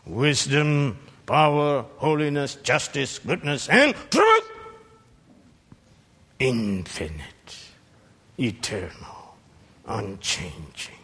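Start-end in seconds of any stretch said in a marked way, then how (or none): 3.7-4.4 high, loud and truth; climactic cry. Acted. Preacher crowns his list of God's attributes. 6.4-10.9 hushed awe. Acted. Preacher repeats attributes in hushed awe.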